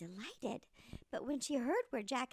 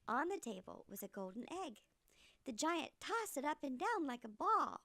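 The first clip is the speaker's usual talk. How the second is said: ordinary delivery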